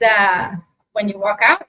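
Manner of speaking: usual speaking voice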